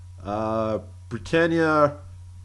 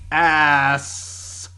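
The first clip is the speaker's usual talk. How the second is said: Ass. with a long hissed S; mock-sexy Ass. with an extended S; stress on the S; 3 semitones higher, 5 dB louder than usual. Shown as a demonstration. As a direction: stretch the S of Ass. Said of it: "Emphasis on the S".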